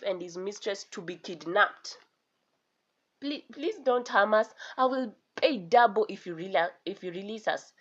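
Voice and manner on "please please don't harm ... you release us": usual speaking voice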